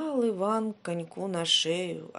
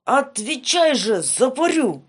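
loud furious demand, pitched up; angry threat; 5 semitones higher, 10 dB louder than usual. Acted. Tsar threatening Ivan with a flogging, loud angry shout.

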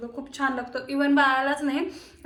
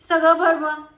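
scolding; 3 semitones higher, 8 dB louder than usual. Real, not acted. Baby spilled food.

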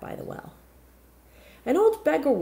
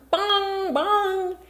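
clock chime; 13 semitones higher, 4 dB louder than usual. Acted. Clock chiming.

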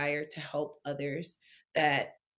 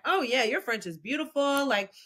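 higher, louder mock gushing praise; enthusiastic approval; 4 semitones higher, 4 dB louder than usual. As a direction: imitate an impressed stranger cheering you on.

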